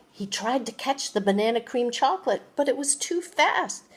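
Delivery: ordinary delivery, nothing marked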